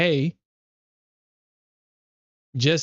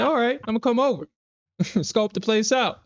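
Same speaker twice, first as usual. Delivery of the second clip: mock menace; 9 semitones higher than usual. Mock threat to scammer.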